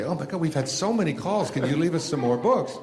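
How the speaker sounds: high-pitched, breathless-sounding mock excitement; imitating excited shopkeepers. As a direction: gush like an overwhelmed bookseller. Acting out delighted bookstore staff.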